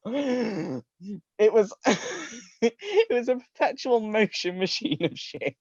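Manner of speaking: high voice, fighting off giggles; laughing while reading. Real, not acted. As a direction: read it while barely holding in laughter. Reader giggling through the passage.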